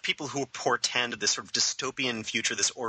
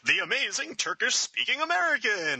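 high showman announcer voice; carnival barker; 10 semitones higher than usual. Retold or imitated. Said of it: "I'm like".

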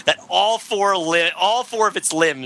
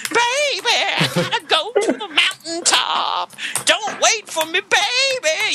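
very high wailing lover's cry; metal power ballad wail; 13 semitones higher, slower than usual. Acted. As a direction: croon it like a hair metal ballad. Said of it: "a forbidden love story".

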